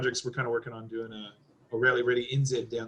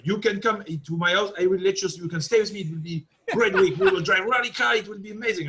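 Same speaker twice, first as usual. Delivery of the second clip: put-on higher-pitched French-accented English; 5 semitones higher than usual. French buddy offering his house, imitated French accent. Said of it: "speak in this terrible French accent".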